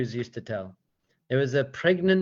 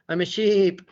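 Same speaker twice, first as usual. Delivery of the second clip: higher, louder mock-sheep cry; mock sheep protest; 5 semitones higher, 5 dB louder than usual. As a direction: insist on it like a silly sheep. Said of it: "they're basically saying to me".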